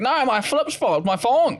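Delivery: put-on high-pitched, indignant Australian accent; Australian retort. Australian defending the word thong, high mock Australian accent. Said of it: "But then they're like".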